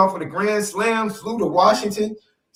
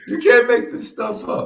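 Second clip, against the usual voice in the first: ordinary delivery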